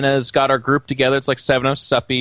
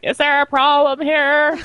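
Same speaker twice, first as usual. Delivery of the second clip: much higher, slow mock-polite challenge; high stern stewardess; 13 semitones higher, slower than usual. Stewardess walking over to the seat dispute, high and slow. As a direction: pitch it up, prim and slow.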